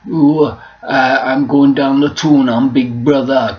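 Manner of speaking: Big Brother voiceover. Shown as a demonstration. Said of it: "the guy who always did Big Brother".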